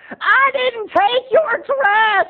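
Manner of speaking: high, drawn-out squabbling screech; hammed-up sisters' fight, screamed slowly; screaming aunt. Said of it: "as Aunt Lauren was screaming".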